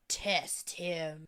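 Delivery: low, cold, evil-sounding voice; emotionless Rand. Acted. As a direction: drop low and drain out all emotion. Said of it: "I like your evil Rand voice".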